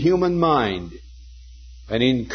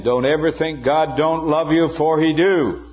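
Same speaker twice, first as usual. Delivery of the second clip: raised, louder, emphatic earnest appeal; intense earnestness; 5 semitones higher, 4 dB louder than usual. Retold or imitated. Preacher quoting Moody saying it again to the students, intensely earnest. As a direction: drive it home in one insistent, high-pitched run. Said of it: "The intense earnestness of this man".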